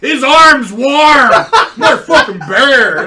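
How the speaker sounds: high, slow laughing shout; laughing yell. Retold or imitated. Trucker yelling to the guy in the cab, laughing shout. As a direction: yell it to your buddy through laughter. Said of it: "yelling to the other guy".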